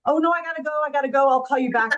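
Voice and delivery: rushed, high, flustered excuse; flustered brush-off. Retold or imitated. Trying to dodge mom's question, flustered and fast. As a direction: hurry off the phone in a panic.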